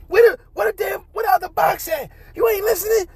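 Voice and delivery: high, far mock scolding mother; imitating angry mom. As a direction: squeal it out like an irate mom.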